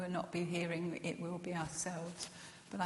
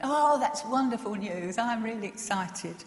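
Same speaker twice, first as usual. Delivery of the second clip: louder, put-on eager delight; deliberately excited; 4 semitones higher, 9 dB louder than usual. Answering the nurse's next call, making a point of sounding excited. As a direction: lay the excitement on thick on purpose. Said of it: "I better sound a bit more excited next time".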